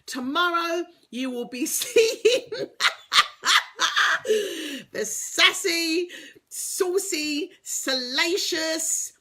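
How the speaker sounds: slow, sultry sassy build-up; sassy tease. Pretend. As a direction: relish each word, teasing. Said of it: "reserved, ladylike version of me".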